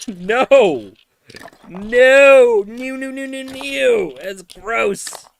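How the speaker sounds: high, loud squealing "no no no"; grossed-out protest. Real, not acted. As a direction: shriek like chat just said something disgusting. Reacting to a gross chat joke.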